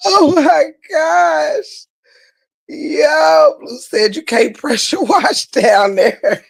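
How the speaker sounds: high squealing words through real tears of laughter; real laugh-crying, voice squeaking up high. Real, not acted. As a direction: barely get the words out for laughing. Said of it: "I'm crying laughing now".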